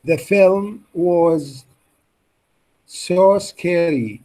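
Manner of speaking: slow classroom demo; slow model reading. Shown as a demonstration. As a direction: draw out every word for the class. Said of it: "copy the stress and intonation".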